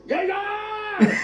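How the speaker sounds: high, drawn-out party whoop. Acted. Friends out drinking late, high rowdy party voice. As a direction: cheer like a rowdy night out. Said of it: "our party voice".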